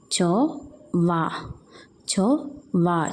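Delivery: each syllable slow and apart. Shown as a demonstration. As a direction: spell it out slowly for the kids. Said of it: "syllable by syllable for children". (പതുക്കെ അക്ഷരം തിരിച്ച്)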